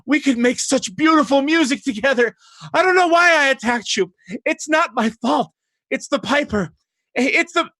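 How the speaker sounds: high, fervent, loud begging; enchanted foe begging forgiveness, trailing off; pleading charmed villain. Acted. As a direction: gush apologies as if lovestruck. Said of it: "and he says".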